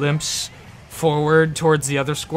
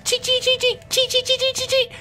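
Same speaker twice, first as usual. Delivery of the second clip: very high chittering; squirrel chatter. Acted. Game master voicing a squirrel chattering, very high. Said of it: "And he goes".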